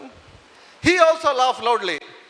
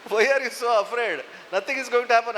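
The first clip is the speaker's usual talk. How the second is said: ordinary talk, nothing marked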